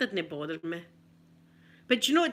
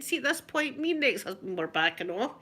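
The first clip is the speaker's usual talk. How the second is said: ordinary talk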